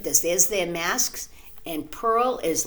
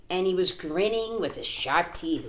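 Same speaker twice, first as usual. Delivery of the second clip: plain reading voice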